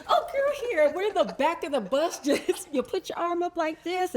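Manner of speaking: high-pitched, laughing take on a friend; imitating a helpful friend. Teammate giving a lesson in the back of the bus, playful high imitation. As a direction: play up a friend's eager how-to in a high voice.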